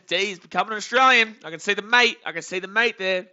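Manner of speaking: loud, high, amused read-out; playful exclamation. Acted. Reads teasing chat about turning Australian.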